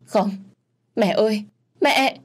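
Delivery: high, loud wail for her mother; screaming. Acted. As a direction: cry out to the dead parents. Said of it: "Dung gào thét".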